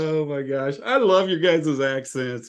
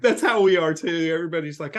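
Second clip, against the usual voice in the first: ordinary talk, nothing marked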